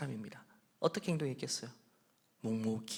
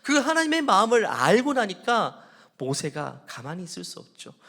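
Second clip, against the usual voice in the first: ordinary delivery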